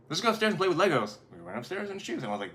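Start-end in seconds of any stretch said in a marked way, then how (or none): 0.1-1.2 put-on higher, quick kid-like invitation; lightly acted kid voice, pitched up; eager child friend. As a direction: rattle it off like a child keen to play.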